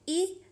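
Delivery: ई as short "i" as in hit; short I sound. Shown as a demonstration. Teacher giving the sound of I, ई as short "i". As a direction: say ई as the I of igloo. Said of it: "जिसका sound है".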